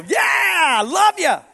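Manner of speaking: high, slow hammed-up cheer; mock joyful yell. Parent cheering the last child out the door, mock shout. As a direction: shout it like you're thrilled the last kid is leaving. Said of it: "the last one you go".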